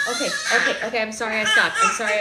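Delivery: loud laughing okay; laughing apology. Real, not acted. Mom laughing sorry to baby for stopping song.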